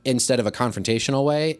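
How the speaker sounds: ordinary delivery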